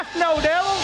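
high mock scream of "F no, devil"; retold scream. Pastor retells the young man screaming, mock yell. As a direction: imitate the young man yelling it, half-hearted. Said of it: "he just screams".

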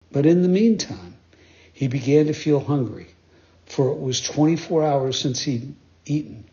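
ordinary delivery, nothing marked

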